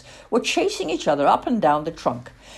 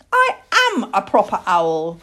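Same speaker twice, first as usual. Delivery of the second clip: mildly indignant, louder protest; cross young owl; 3 semitones higher, 6 dB louder than usual. Acted. Owl chick defending himself to the girl, mildly cross. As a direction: insist with a touch of crossness. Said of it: "getting very cross".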